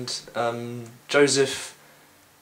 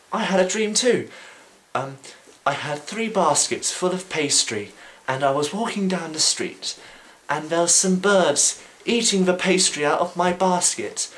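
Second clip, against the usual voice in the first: eager baker; 8 semitones higher than usual. Retold or imitated. Baker tells his dream.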